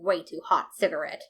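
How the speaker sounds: ordinary delivery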